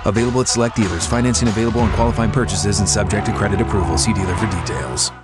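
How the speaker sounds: rapid fine-print read; fast legal disclaimer. Acted. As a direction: rush through the small print.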